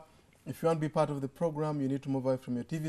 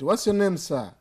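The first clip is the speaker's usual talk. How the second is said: loud, higher call over a bad line; to someone hard of hearing; 5 semitones higher, 9 dB louder than usual. Real, not acted. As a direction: shout the question down the line. Caller hard to hear.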